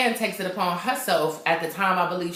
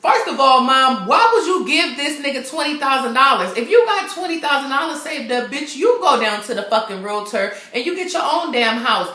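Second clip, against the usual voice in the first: exasperated scolding; 5 semitones higher, 3 dB louder than usual. Real, not acted. Reviewer scolds the movie mom.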